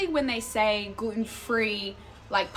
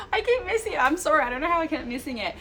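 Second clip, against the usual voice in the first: laughing apology; 7 semitones higher, faster than usual. Real, not acted. Laughs at missing chat messages.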